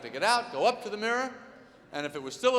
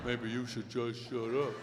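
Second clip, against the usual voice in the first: slow, low, softer mock-man voice; imitated man's voice; 10 semitones lower, 6 dB quieter than usual. Wife mimicking husband to taunt him, slow low voice. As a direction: drag it out low to needle him. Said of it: "imitate the voice of the man".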